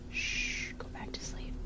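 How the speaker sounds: soft shushing whisper; whispering over a crib. Real, not acted. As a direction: hush someone back to sleep. Rachel waking up in bed.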